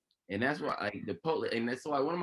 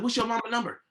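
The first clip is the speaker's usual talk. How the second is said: higher, urgent mom asking fast; 7 semitones higher than usual. Retold or imitated. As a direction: press for the number quickly. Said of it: "my mom like".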